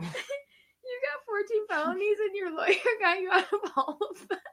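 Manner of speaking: laughing through pain. Real, not acted. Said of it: "It hurts to laugh".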